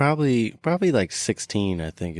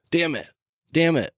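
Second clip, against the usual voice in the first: higher-pitched imitation of a child; little kid swearing; 8 semitones higher than usual. Imitating daughter's swear word, childlike. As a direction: curse like a small child, pitched up. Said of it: "my youngest will say, or my middle".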